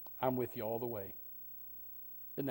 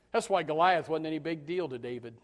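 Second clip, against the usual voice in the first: usual speaking voice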